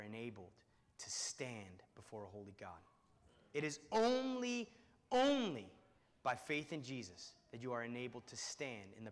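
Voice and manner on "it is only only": only drawn out, much higher and louder; stress on only. Acted. Preacher insisting to congregation, stressing only. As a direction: lean hard on only, twice. Emphatic repeated word.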